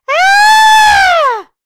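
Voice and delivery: long, loud, very high acted scream; dreadful shriek. A narrator's reading. Horrible cry breaking the silence of the night, dreadful shriek. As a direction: let out one sustained piercing shriek. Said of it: "a yell of pain and fear and anger".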